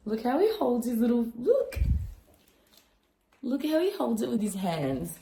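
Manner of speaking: high cooing voice for the puppy. Real, not acted. Owner coos at puppy eating food, high baby talk.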